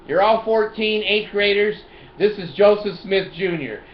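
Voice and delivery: playful fake police bulletin; mock cop announcement. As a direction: announce it like a pretend officer.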